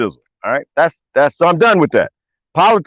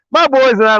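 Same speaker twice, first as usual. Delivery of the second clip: normal talking voice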